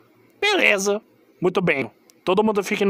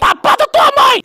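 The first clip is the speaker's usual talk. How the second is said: high, loud indignant shout; angry yell; 8 dB louder than usual. Acted. Ozzy snaps back at Fligo for calling him dumb, angry yell. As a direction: bark the comeback at full volume.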